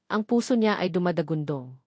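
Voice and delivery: ordinary delivery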